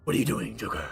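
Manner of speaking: measured, breathy growl with long pauses; Begins Batman impression. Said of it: "then Begins Batman is like".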